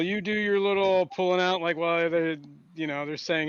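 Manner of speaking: usual speaking voice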